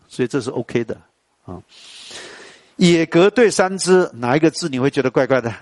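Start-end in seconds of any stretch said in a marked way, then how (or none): 2.7-4.1 slow recitation. Shown as a demonstration. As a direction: chant the couplet slowly and evenly. Chants a couplet slowly. (慢慢吟诵)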